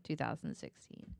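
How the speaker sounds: normal talking voice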